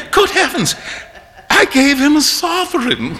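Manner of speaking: high rueful dismay; dismayed cry. A narrator's reading. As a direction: realise your blunder aloud. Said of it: "cried Hughie".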